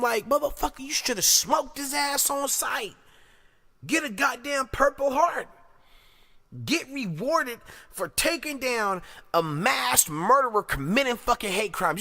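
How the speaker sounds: high-pitched, heated rant; outraged scolding. Real, not acted. Commentator scolding those who spotted the killer, high and heated.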